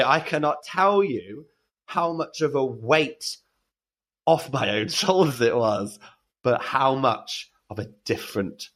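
ordinary talk

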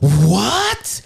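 high, startled rising question; alarmed what. Retold or imitated. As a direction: blurt it in disbelief. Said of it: "all of a sudden she's like".

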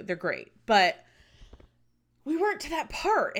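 ordinary talk, nothing marked

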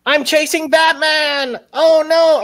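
Andy Dick's police officer squealing in panic; silly cop voice. Retold or imitated. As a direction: play the panicked officer chasing Batman. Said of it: "And he's like".

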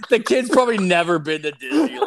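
high, loud, laughing disbelief. Real, not acted. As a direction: crack up while saying it. Cracking up at the elevator story.

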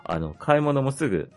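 normal talking voice

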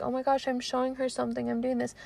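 mocking smug guy. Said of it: "some of you guys think".

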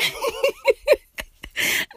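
long high-pitched cackle; squealing laugh. Real, not acted. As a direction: crack up laughing. Laughing at herself.

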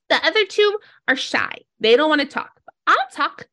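small critter bravely volunteering; Donkey. Acted. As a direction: speak up high for your shy friends. Voices Donkey to the player.

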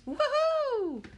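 very high, loud woohoo; thrilled kid's woohoo; whooping cheer. Acted. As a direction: whoop with delight. Winner cheering.

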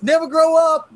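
high, loud playful yell; loud childish taunt; gleeful shout. Pretend. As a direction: shout it like a kid running off. Mocking shout while running away.

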